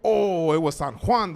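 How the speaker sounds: ordinary delivery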